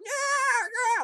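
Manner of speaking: high yowling cat imitation; cat screech. Imitating screaming cats.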